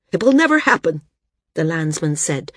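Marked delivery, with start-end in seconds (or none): 0.1-1.0 high, loud protest, too shrill. A narrator's reading. Landsman denies flyers could end tradition in blood, shrill. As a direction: pitch it up into a shrill denial. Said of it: "too shrilly".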